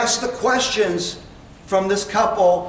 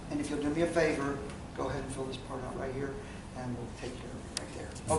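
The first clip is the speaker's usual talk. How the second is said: quiet request; 7 semitones lower, 15 dB quieter than usual. Shown as a demonstration. Salesman demo handing the pen.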